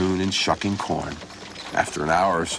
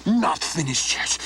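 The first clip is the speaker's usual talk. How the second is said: angry; 10 semitones higher than usual. Acted. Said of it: "you sound angry".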